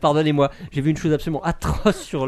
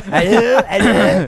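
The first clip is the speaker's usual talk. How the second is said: allô moqueur; 4 semitones higher, 7 dB louder, slower than usual. Pretend. Imite un appel reçu.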